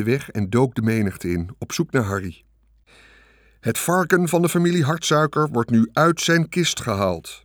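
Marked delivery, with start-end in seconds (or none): 3.6-7.4 droning loudspeaker. A narrator's reading. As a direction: drone it out over the crowd. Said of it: "dreunde de stem uit de luidspreker".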